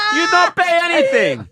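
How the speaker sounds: put-on high, Italian-accented villa owner ordering them out. Italian villa owner kicking out the friends, in an Italian accent. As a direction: bark it like an offended Italian host. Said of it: "Your Italian accent".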